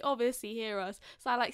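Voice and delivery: ordinary delivery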